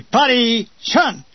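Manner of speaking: mock sergeant calling the party to attention; drill command.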